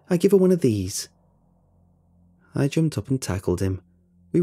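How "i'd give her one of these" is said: loud, cocky and playful; playful challenge. A narrator's reading. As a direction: throw down a cheeky dare. Playful threat before tackling.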